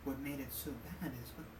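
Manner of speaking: soft storytelling voice. Acted. GM narrates in character.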